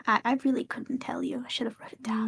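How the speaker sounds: whispering. Acted. Character whispers in play.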